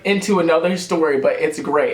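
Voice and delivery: normal talking voice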